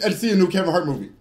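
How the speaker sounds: normal talking voice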